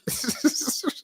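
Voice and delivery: high, airy giggle; wheezy laugh at his own story; real laugh. Real, not acted. Storyteller cracking up at the dad's line, high wheezy laugh.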